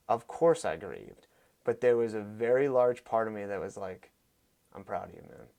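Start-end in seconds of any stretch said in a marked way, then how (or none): 4.7-5.5 tender grief. Real, not acted. Fighter to his friend who died in the ring, tender grief. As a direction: drop the voice, halting and tender.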